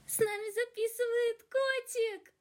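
very high, soft cooing voice. Real, not acted. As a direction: coo at the kitten. First take of cat line.

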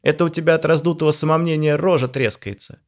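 a lightly voiced rude friend teasing; mocking Zhora. A narrator's reading. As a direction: jab at him with scorn.

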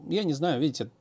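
ordinary talk

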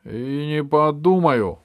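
put-on stubborn boy talking back loudly; defiant retort. A narrator's reading. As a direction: refuse defiantly, stretching the words. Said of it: "огрызнулся Пашка".

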